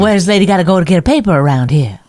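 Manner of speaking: quick mock Mid-Atlantic accent; forties lady. Asked to do the forties lady, quick Mid-Atlantic lady. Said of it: "Do the lady from the forties".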